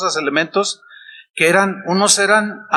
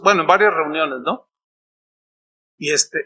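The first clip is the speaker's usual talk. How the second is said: ordinary talk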